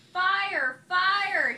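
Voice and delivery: loud high alarm shout; loud panicked alarm call. A narrator's reading. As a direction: yell fire to scare them off. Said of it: "he cried".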